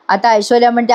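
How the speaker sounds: usual speaking voice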